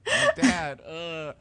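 hammed-up crying son; mock sob. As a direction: blubber it out. Chase crying in recap.

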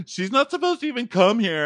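high, gasping imitation of a wronged woman; hammed-up indignant whine; mock tearful protest. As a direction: protest it high, catching your breath. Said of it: "she's still sobbing".

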